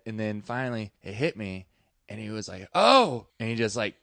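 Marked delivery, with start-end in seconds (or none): 2.7-3.3 sudden realization. Retold or imitated. Buddy suddenly gets the joke.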